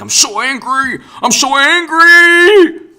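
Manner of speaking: slow, overblown fake fury building up; mocking angry voters howling their outrage. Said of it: "Everything is apoplectic".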